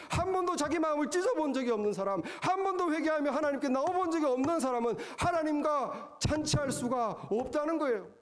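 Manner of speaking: building sermon cadence, pitch climbing; impassioned preacher's build; rising preaching. Acted. Preacher at full force.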